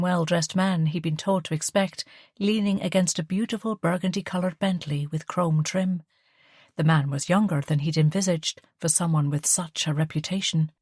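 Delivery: usual speaking voice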